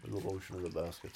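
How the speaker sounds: low, hushed creepy villain voice; quoting the villain, low and eerie; creepy film villain. Acted. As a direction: purr it like a menacing captor.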